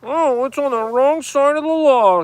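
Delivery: a goody-goody reciting the rules; mock Boy Scout. Cop acting like a Boy Scout, high mocking voice. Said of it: "act like a Boy Scout about it".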